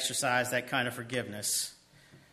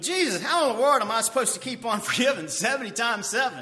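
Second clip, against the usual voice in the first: exasperated disciple; 8 semitones higher, 5 dB louder than usual. Pretend. Imagined disciple's exasperated question.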